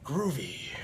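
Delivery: rougher, breathy catchphrase; Ash. Acted. Trying the Ash versus Evil Dead voice, rougher impression. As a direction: try the older hero's rougher voice. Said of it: "Ash versus Evil Dead one".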